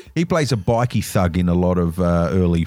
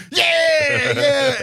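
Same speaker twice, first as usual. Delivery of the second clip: high excited shout; bad acting. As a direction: shout it like a hammy cheer. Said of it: "someone will go".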